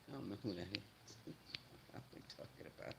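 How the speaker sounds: very soft, quick words through quiet laughter; stifled laughing. Real, not acted. Puzzled streamer reading the chat, quietly laughing. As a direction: laugh under your breath, nearly voiceless. Giggling at an unknown chatter.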